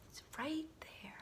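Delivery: whisper. Real, not acted. Reader points to picture.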